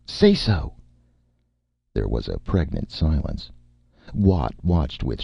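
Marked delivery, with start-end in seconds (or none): 0.0-0.7 higher, urgent command; crying out. A narrator's reading. Erica pressing Nick to refuse, urgent cry. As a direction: urge it out of them. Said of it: "Erica cried".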